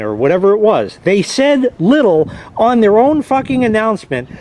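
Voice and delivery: ordinary talk, nothing marked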